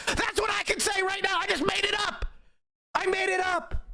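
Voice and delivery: high, strained outburst; exasperated shouting. Real, not acted. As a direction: yell it out in sheer frustration. Exasperated rant at played clip.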